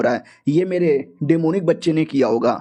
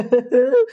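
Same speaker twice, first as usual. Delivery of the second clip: villain laugh; 6 semitones higher than usual. Acted. Villain gloating over trapped girl.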